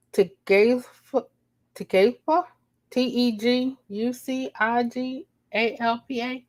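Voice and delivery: Tegucigalpa sounded out, rising and unsure; unsure pronunciation. Real, not acted. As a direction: fumble Tegucigalpa then spell it. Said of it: "I don't know how to say this".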